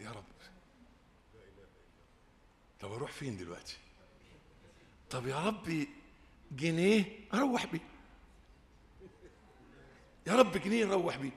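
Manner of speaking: quiet pleading prayer to God; hushed plea. Retold or imitated. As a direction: pray quietly upward, helpless and small. Stranded sheikh prays at night.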